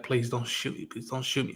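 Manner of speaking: soft, hushed begging; quiet pleading. Retold or imitated. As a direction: plead softly so she lowers the gun. Ellie begging Dina quietly.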